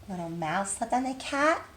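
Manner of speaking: ordinary delivery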